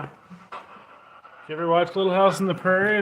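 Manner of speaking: ordinary talk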